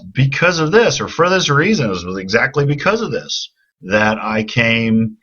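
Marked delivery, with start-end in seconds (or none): 0.0-1.8 this said high and stressed; contrastive stress. Shown as a demonstration. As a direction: lean on this for the contrast. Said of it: "and then he contrasts".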